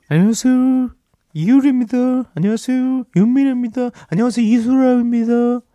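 높은 목소리로 여가수 흉내 바꿔가며; 세 가수 목소리를 차례로 흉내 내기; 성대모사 바꿔가며. Acted. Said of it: "성대모사로 어떻게 힌트를 주죠?". (여가수 성대모사를 번갈아 하는 목소리)

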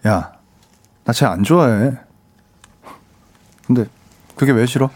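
low, composed love confession; steady romance acting. Idol confessing in a drama scene, low and steady. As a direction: confess in a low voice with long pauses. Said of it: "안정감이 조금 있네".

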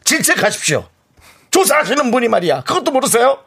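high, indignant scolding voice; mock scolding. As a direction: tell off the caller in playful outrage. Mock scolding the survey caller. (장난스럽게 호통치는 말투)

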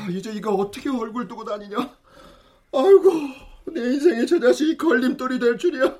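despairing mutter. Acted. Father laments to himself about his son's scandal, despairing and soft. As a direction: grumble in defeat.

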